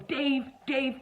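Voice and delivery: high-pitched call for someone. Retold or imitated. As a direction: imitate a man summoning his mate by name. Man calls out for someone.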